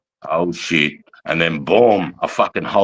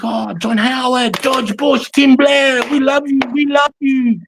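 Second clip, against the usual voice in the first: much higher, slowed, sing-song praise; imitating grateful locals; slower than usual. Soldier imitating Iraqis cheering the Australians, high mock praise. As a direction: gush like an overjoyed local welcoming troops. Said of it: "they're like".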